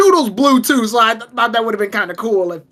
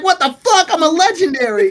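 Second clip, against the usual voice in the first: offended legendary; 7 semitones higher than usual. Retold or imitated. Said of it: "the Suicune would've been like".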